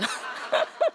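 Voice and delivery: breathless high laugh; airy laugh. Real, not acted.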